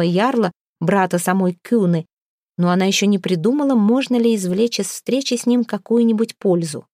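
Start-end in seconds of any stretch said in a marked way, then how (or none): none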